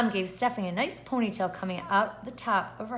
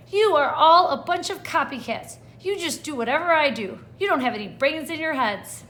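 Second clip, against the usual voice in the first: indignant scolding; 5 semitones higher, 5 dB louder than usual. Acted. Stephanie scolds copycat classmates.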